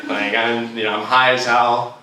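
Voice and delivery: ordinary talk, nothing marked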